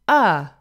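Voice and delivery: "uh" as the vowel in "but"; short U sound. Shown as a demonstration. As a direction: say "uh" like the vowel in "come". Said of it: "It's the short U sound".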